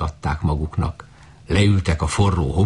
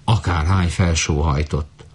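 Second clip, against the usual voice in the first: usual speaking voice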